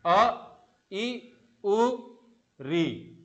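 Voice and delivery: "अ, ई, उ, री" each said short and quick; quick drill of "अ, ई, उ, री"; short vowels demo. Shown as a demonstration. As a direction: run through "अ, ई, उ, री" briefly. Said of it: "समय एकदम कम लग रहा है".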